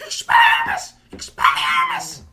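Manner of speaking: very high shriek, then dropping; squealed spell. Retold or imitated. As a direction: screech the spell like a desperate kid. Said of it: "he's like".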